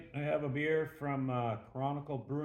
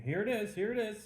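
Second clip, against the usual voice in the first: much higher, sing-out voice; acted eager presenting; 8 semitones higher than usual. Bar patron presents hide to blindfolded hunter, eager. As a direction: imitate someone eagerly handing it over.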